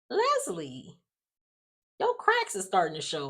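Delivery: sassy knowing warning; knowing call-out. Retold or imitated. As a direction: warn her, half teasing. Calling out TV character.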